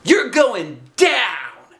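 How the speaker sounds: very high, loud, slow taunt; shout. A narrator's reading. Arlo shouting at Andrea across the gym. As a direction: yell the challenge. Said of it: "I shouted".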